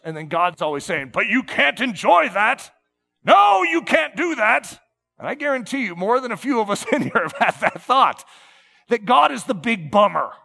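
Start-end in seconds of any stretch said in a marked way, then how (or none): 1.1-4.8 louder, higher mock forbidding; mock stern God. Preacher mimicking a killjoy God, mock stern and loud. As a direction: ham up a killjoy God saying no.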